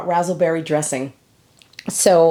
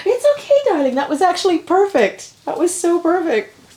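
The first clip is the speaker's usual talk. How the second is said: reassuring daughter; 10 semitones higher than usual. Real, not acted.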